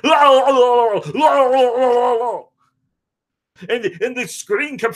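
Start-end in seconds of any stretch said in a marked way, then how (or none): 0.0-2.4 silly singsong. Retold or imitated. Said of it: "I was like".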